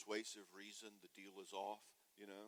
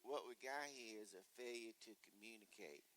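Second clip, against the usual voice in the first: movie quote; 6 dB quieter than usual. Retold or imitated. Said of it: "as they say in Cool Hand Luke".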